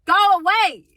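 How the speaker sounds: loud, sharp go away; mock loud dismissal; loud brush-off. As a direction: push him off. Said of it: "I have to be like".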